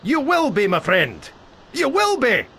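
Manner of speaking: high, emphatic assurance; eager promise. Acted. Guide assuring the bard of pay, eager promise. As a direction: sell it hard.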